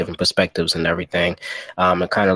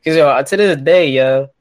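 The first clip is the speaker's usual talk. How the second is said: excited; 8 semitones higher, 4 dB louder, slower than usual. Real, not acted. Excited story about Training Day.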